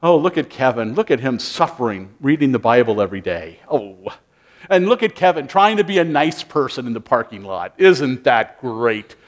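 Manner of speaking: mocking impressed tone; sarcastic mock praise. Mocking onlooker praising Kevin.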